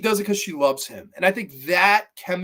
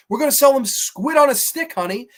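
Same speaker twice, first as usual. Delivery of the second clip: excited husband; 9 semitones higher, 5 dB louder than usual. Retold or imitated. Quoting Reeve's character to wife.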